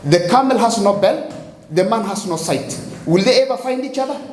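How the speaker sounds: normal talking voice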